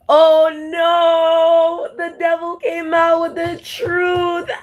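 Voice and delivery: high-pitched, breaking into crying laughter; words squeezed out through helpless laughter; laughing hard. Real, not acted. Laughing fit over devil story.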